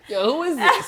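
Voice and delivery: high, loud, laughing "who is this"; laughing surprise. Retold or imitated. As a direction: burst out laughing in disbelief. Reacting to his energy on phone.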